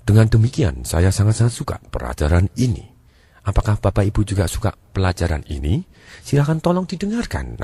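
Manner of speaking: auditory-type talker, sing-song tune up to "didengarkan"; wavy intonation. Shown as a demonstration. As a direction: let "sangat-sangat suka" and "pelajaran ini" undulate. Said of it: "nadanya mulai bergelombang".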